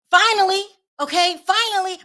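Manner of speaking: loud, high "finally" thrown up with relief; big relieved finally; exaggerated relief. Real, not acted. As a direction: cheer it like it's long overdue. Relief at mayor cutting him off.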